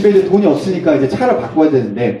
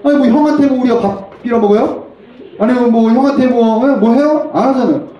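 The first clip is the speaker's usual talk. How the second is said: high-pitched, worked-up grievance; indignant protest; 8 semitones higher than usual. Acted. Younger brother protesting he never asked for food, indignant. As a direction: argue it indignantly, voice pushed up high.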